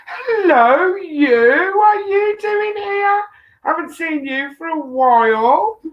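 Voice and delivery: high-pitched, drawn-out delighted hello; excited greeting. Real, not acted. Host greets a rare visitor popping in, excited. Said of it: "I got really excited 'cause Jamie's popped in".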